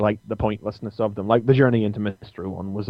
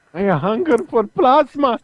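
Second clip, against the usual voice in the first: whiny vampire voice; 13 semitones higher, 3 dB louder than usual. Retold or imitated. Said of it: "that little whiny voice in my head".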